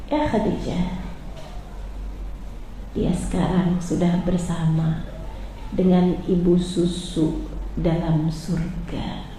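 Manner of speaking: soft, low, slow comfort; tender reassurance. Acted. Prophet tells grieving Khadijah of paradise, soft and slow. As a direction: reassure her gently.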